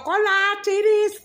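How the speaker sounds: put-on high, loud child's yell; retold shout. Mom imitating daughter's old shouting, a loud yell. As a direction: yell it out like the kid used to. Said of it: "Antes para todo andaba gritando".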